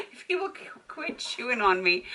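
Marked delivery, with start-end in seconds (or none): 0.0-0.8 laughing. Real, not acted. Laughs while dog chews.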